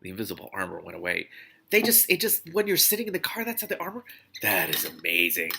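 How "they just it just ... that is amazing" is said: high, fast-tumbling wonder; amazed. Real, not acted. Reader breaking off the book to marvel, amazed. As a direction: gush about the armor.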